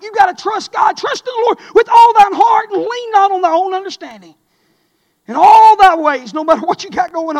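loud, high, driving preacher's voice; fiery preaching. Acted. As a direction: preach it hard to the congregation. Preaching at full force.

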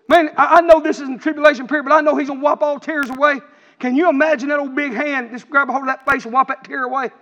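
ordinary talk

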